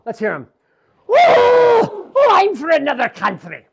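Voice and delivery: loud whoop then a loud excited cry. Acted. Tig whooping with glee before flying off. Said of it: "Tig hearing this says".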